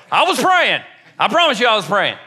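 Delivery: high, loud defensive outburst; hammed-up indignant insistence; mock protest. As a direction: protest it loudly in comic exasperation. Protesting to imagined critics.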